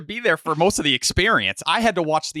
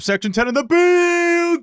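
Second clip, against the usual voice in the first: high, stretched hype-man holler; hyped call-out; 3 dB louder than usual. Pretend. Co-host greeting Section Ten, hyped high holler. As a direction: sing out the last word high.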